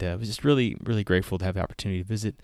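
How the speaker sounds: ordinary talk, nothing marked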